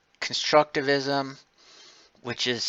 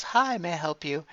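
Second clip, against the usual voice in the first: bright, much higher customer-service voice; cheery waitress; 7 semitones higher than usual. Retold or imitated. Teacher acting a waitress greeting a customer, high and sugary. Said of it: "goes up to someone and says".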